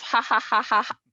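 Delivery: mock forced preacher laugh, ha by ha; Kenneth Copeland laugh. Podcast host mocking a preacher's laugh, forced ha-ha-ha. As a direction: imitate a televangelist's staged laughter. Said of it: "I think of Kenneth Copeland's laugh".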